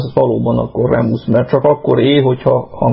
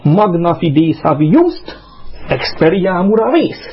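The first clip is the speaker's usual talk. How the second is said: scanned Latin recitation; 5 semitones higher than usual. Shown as a demonstration. Showing off memorised Ovid, scanned distichs. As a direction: chant the verse in strict feet. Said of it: "Ezt így kell skandálni".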